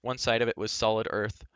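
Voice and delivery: ordinary delivery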